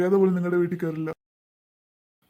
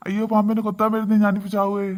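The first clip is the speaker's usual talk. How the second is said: hammed-up panic; 3 semitones higher than usual. Scared husband calling for help from the snake. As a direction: yell for help as if the snake strikes.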